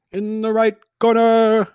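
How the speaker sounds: slow, booming ring announcer voice; stadium announcer. Acted. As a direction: call out the fighter like at a WWE ring. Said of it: "like a stadium announcer".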